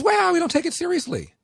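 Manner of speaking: hammed-up dismissive reply; mock congressman. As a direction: play him as an unbothered colleague. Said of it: "And he goes".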